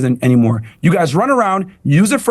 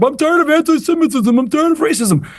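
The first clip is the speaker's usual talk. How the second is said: high, sneering parody of the rabbi's words; mocking impression; 9 semitones higher than usual. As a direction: throw his own line back at him in a whiny voice. Mimicking the rabbi's earlier words.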